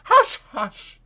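high, loud, urgent shushing; alarmed hushing. A narrator's reading. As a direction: exclaim it to cut him off. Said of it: "exclaimed the doctor".

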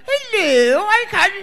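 hammed-up posh upper-class English accent; mock posh English accent; mock posh British. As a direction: play a Downton Abbey aristocrat, lightly mocking. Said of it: "you all think all British people talk like".

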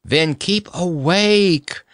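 slow, high yawny drawl; yawning. A narrator's reading. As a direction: say it through a drawn-out, mild yawn. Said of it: "yawned Dorothy".

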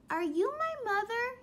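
high, pleading little bird; lightly voiced chick asking; a hint of a small lost bird; baby bird voice. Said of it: "he said".

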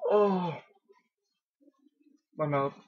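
ordinary talk

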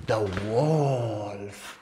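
long, swooping "wolf"; slow picture-book menace; ominous storyteller. Shown as a demonstration. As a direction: draw out the wolf suspensefully. Demonstrates telling the story to toddlers.

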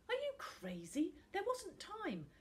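high-pitched indignant disbelief; incredulous retort. A narrator's reading. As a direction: protest with the voice leaping up. Boy hotly answers friend's reproach.

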